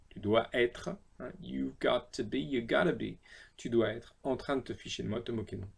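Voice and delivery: ordinary talk, nothing marked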